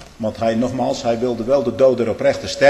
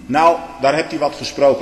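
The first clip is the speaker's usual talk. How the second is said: usual speaking voice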